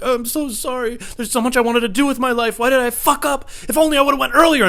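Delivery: high-pitched, hammed-up dying apology; mock deathbed regret. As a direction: lament from the hospital bed, high and melodramatic. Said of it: "on a respirator".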